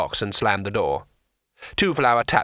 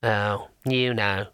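cautious imp; 2 semitones lower, much slower than usual. A narrator's reading. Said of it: "said the demon cautiously".

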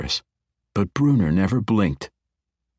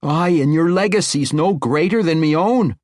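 higher, louder outburst; blurted; 11 semitones higher, 5 dB louder than usual. Acted. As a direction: let it burst out before thinking. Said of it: "General Dagnabbit blurted".

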